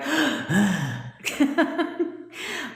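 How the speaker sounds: mock gasp. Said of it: "I run up five stairs".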